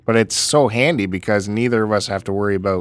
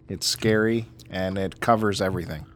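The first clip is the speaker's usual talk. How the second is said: ordinary delivery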